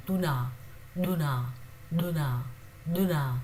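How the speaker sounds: duna said clearly, repeated; pronunciation demo. Shown as a demonstration. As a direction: model duna evenly. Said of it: "Just as we saw over here".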